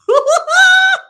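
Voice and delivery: loud high shriek of laughter. Real, not acted. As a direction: burst out in a piercing cackle at your own joke. Laughing at own pun.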